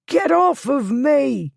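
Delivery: high, loud panicked scream. A narrator's reading. Thief screaming at Blackwood as he's grabbed, high and loud. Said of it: "the thief screamed".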